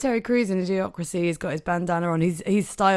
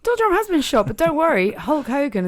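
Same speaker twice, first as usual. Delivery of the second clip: dramatic announcement; 8 semitones higher, 6 dB louder than usual. Acted. Mock dramatic news announcement.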